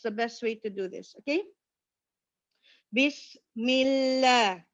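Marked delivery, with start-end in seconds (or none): 2.9-4.7 slow "Bismillah" with lips spread; smiling light lam. Shown as a demonstration. Teacher shows smiling light lam to students. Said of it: "Okay, smile".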